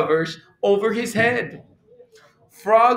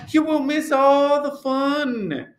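high-pitched pleading protest; crying out; 7 semitones higher than usual. A narrator's reading. Frog urging Toad not to sleep, crying out. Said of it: "cried Frog".

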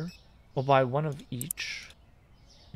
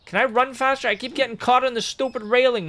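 much higher, louder complaint; frustrated outburst; 9 semitones higher, 4 dB louder than usual. Real, not acted. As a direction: whine at the railing. Stuck on railing while rushing.

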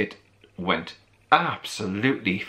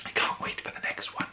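breathy whispered excitement; whispering; 8 dB quieter than usual. Real, not acted. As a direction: whisper it like a secret. Whispered excitement about sequel.

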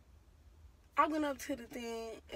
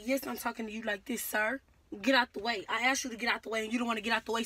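ordinary talk

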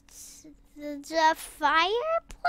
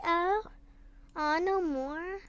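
ordinary talk, nothing marked

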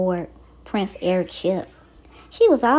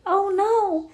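worried; 10 semitones higher than usual. A narrator's reading. Storm danger in the story.

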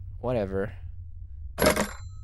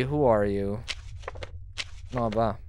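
ordinary talk, nothing marked

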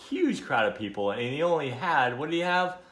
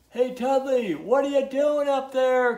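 high, loud holler; storybook character calling upward; calling from below; 8 dB louder than usual. A narrator's reading. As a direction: shout up to someone far above. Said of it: "called a voice from below".

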